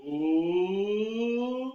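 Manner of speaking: slow upward slide on ooh; rising ooh siren. Shown as a demonstration. Voice teacher demonstrating a rising ooh siren. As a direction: glide ooh smoothly upward. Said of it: "And a ooh".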